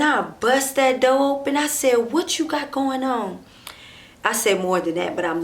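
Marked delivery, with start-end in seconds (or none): 2.1-3.4 raised pitch, demanding challenge; a touch of an angry confrontation; retold confrontation. Woman bursting in on her cheating boyfriend, mildly angry challenge.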